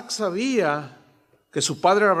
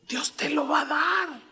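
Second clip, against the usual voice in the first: hushed reassurance, pitched up; whispered promise; 9 semitones higher, 3 dB quieter than usual. Retold or imitated. Father Abraham reassures Isaac in a whisper. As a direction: whisper it like a secret blessing.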